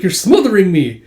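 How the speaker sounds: put-on irritation; acted exasperated complaint. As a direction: complain loudly, as if being squashed. Same character protests the hug.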